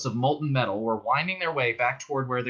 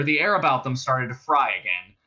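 ordinary delivery, nothing marked

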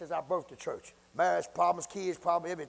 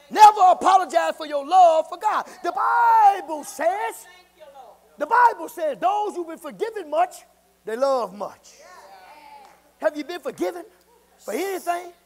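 loud, high shouted call to praise; preacher shouting the congregation into praise; full-force preaching; 11 semitones higher, 14 dB louder than usual. Acted. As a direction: whip the church up to its feet. Calls congregation to stand.

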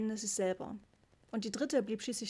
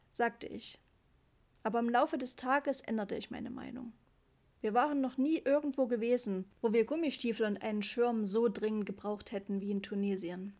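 plain reading voice

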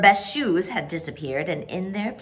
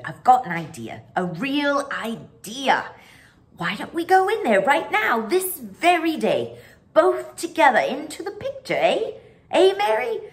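rising higher and louder with eagerness; very excited; 8 semitones higher, 5 dB louder than usual. Acted. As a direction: burst out with the idea, more and more eager. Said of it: "looking very excited".

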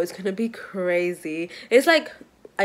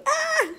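sudden high squeal of joy; excited squeal. Real, not acted. Squealing to viewers about her news. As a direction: let out a burst of a squeal.

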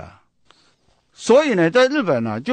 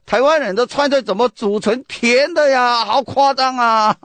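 higher, louder Sichuanese grumble with stress on 湾, slowing at the end; loud Sichuan dialect; 6 semitones higher than usual. Retold or imitated. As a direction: imitate a tourist's loud disbelief in Sichuanese. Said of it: "这个用四川话很大声讲啊". (用四川话大声质问)

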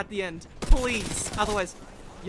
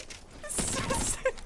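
high breathy cackle; gleeful laugh; 13 semitones higher, 5 dB quieter than usual. Real, not acted. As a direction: laugh like a tyrant. Laughing while chasing enemies.

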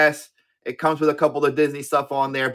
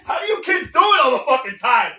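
laughing; 8 semitones higher than usual. Real, not acted. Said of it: "laugh shout".

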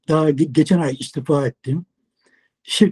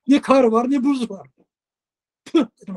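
normal talking voice

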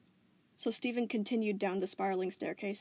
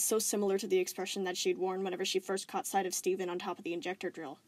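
plain reading voice

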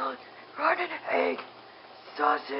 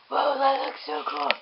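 ordinary talk, nothing marked

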